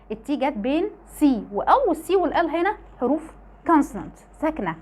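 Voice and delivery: ordinary talk, nothing marked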